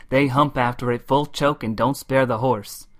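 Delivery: ordinary delivery, nothing marked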